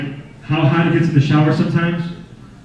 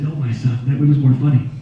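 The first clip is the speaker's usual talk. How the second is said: wise cat voice; 3 semitones lower than usual. Pretend. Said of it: "He said".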